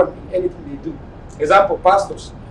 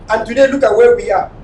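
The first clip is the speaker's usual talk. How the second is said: ordinary talk, nothing marked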